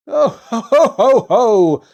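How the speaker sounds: loud, high ho ho ho; merry Santa laugh. A narrator's reading. Santa spots the two children and laughs loudly and merrily. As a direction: belly laugh full of joy. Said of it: "he laughed merrily".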